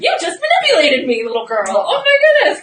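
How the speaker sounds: loud, high-pitched playful indignation; mock outrage. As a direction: scold the baby in laughing disbelief. Acts out mock outrage at baby.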